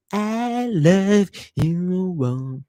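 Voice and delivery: very slow, stretched-out thanks, each word pulled long; drawn-out love you. Acted. Streamer to his viewers, crooning his thanks slowly. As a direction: croon it lazily to the chat.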